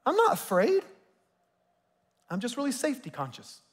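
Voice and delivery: defensive, with NOT jumping high; mock denial. Preacher mimics a believer faking calm, mock defensive. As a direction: playfully fake the calm denial.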